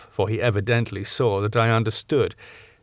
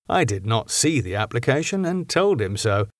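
ordinary delivery, nothing marked

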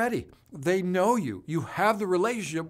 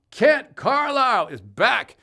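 drawn out, much higher and louder welcome; excited welcome; 9 semitones higher, 9 dB louder, much slower than usual. Real, not acted. Host greeting returning viewer Kent, excited drawn-out welcome. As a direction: stretch it out like a host hailing a regular.

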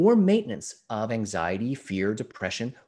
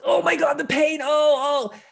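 high, loud wail of pain; mock pained shouting, voice pitched way up; acted agony; 14 semitones higher, 7 dB louder than usual. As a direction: cry out in hammed-up agony. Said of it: "on the ground grabbing his leg, going".

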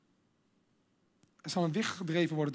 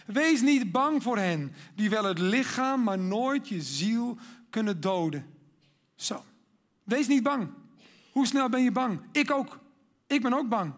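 preaching at full force; 4 semitones higher, 5 dB louder than usual. Acted. Preacher urging the congregation not to be afraid, loud and fervent. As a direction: rouse the hall with a fearless call.

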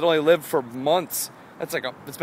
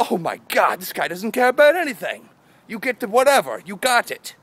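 higher, louder gushing admirer; acted amazement; 7 semitones higher, 6 dB louder than usual. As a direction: gush in mock awe. Acts out others' amazed admiration.

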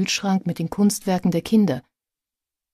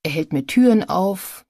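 usual speaking voice

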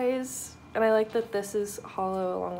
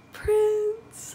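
surprised gasp; 12 semitones higher, 4 dB louder than usual. Real, not acted. Gasp on seeing the card.

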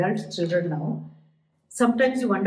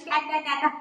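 higher-pitched mock toddler voice; imitated baby babble; 7 semitones higher than usual. Coach mimicking a child's first words, playful higher-pitched babble. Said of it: "for a child saying".

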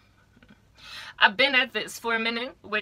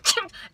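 small, high imitated sneeze; mock sneeze; 11 semitones higher than usual. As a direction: fake a short stifled sneeze. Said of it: "maybe sneeze a bit".

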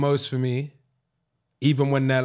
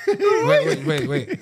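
ordinary delivery

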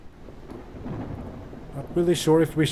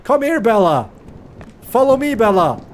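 loud, higher coaxing call; calling the dog; 6 semitones higher, 6 dB louder than usual. Real, not acted. Coaxing his dog to follow, loud high call. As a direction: call her over from far away.

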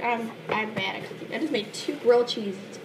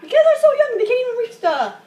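high, loud exasperated protest; real worked-up sibling squabble; heated arguing; 12 semitones higher, 10 dB louder than usual. Real, not acted. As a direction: argue back shrieking at your sister. Heated argument with sister over cooking.

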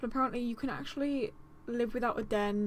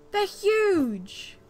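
high, loud startled exclaim; real shock; amazed cry; 8 semitones higher, 8 dB louder than usual. Real, not acted. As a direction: blurt it out in awe. Gasps at huge wolves.

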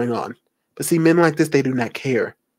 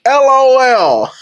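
high, loud, stretched-out letters; hammed-up mock glee; gleeful creep; 9 semitones higher, 6 dB louder, slower than usual. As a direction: crow the letters slowly. Reads LOL as the creep.

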